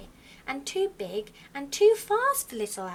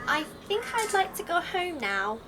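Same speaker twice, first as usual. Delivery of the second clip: little owl voice; 7 semitones higher than usual. Acted.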